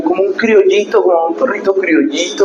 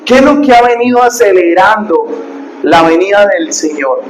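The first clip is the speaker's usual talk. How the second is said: fiery preaching; 4 semitones higher than usual. Acted. Pastor warning his congregation about false teachers, in fiery preaching. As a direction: preach it hard, warn the flock.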